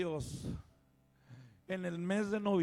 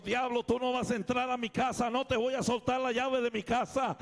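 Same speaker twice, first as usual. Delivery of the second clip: rebuking the devil; 5 semitones higher, 6 dB louder than usual. Retold or imitated. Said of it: "lo comenzó a reprender".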